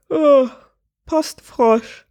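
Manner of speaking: high, sleepy yawn through the words. A narrator's reading. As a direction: answer halfway through a waking yawn. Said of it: "gähnte Sophia".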